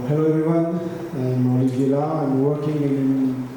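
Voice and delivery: normal talking voice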